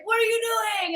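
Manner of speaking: hammed-up dugout shout; mock team yell. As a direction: ballplayers yelling at her in disbelief. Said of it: "The whole team in the dugout is like".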